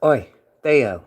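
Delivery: put-on playful British accent, lightly done; mock British accent. Tempting pet Theo out in a mock British accent. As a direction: tease in a cheeky British voice. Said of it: "bust out the British voice again".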